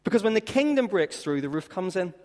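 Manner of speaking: rising, emphatic preacher voice; preaching. Acted. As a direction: preach it out with fire. Preaching climax at full force.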